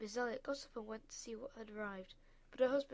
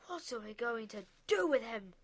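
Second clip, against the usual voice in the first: louder, fed-up father's voice; exasperated father; 8 dB louder than usual. A narrator's reading. Father fuming about expelled son, exasperated. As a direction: throw up your hands at the boy.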